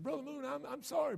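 high, small apologetic voice; Bobby apologizing. Retold or imitated. Bobby apologizing to Brother Moon, high and meek. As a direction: say sorry like a beaten man. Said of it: "He said".

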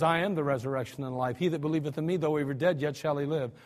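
low, soft, solemn reassurance; calm Jesus. Acted. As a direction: speak it gently and low, like a promise. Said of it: "Jesus says".

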